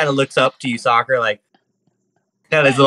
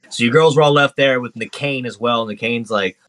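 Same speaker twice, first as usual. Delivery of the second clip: ordinary talk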